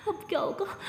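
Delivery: high, put-on tearful whine; fake crying. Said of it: "ऐसे बोल के रोने की एक्टिंग करती है". (रोने की नकली एक्टिंग, ऊँचे सुर में)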